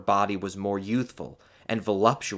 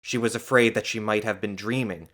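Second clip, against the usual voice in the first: plain reading voice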